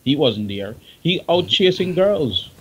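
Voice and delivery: normal talking voice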